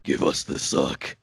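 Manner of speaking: low, slow growling symbiote voice; deep monster voice. Retold or imitated. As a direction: drop low and drag it out like a monster. Quoting Venom symbiote's line.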